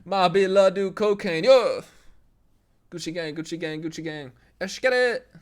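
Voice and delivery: high, loud rapper chant. Retold or imitated. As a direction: chant the hook in a hyped rapper voice.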